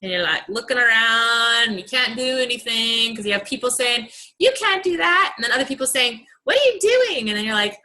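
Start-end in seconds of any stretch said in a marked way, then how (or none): 4.4-5.3 higher, louder mock scolding; a mocking naysayer voice. Mocking naysayers in brick wall. 6.4-7.3 higher, louder mock disbelief; mocking what-are-you-doing; mock critic. Mocking naysayers in brick wall.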